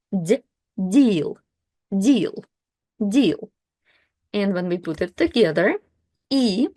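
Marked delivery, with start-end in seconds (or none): 0.9-3.5 deal with a soft D as in Russian ДИ. Shown as a demonstration. Teacher modelling a soft Russian D, careful. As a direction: say deal with the D softened before the vowel. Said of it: "kinda like D".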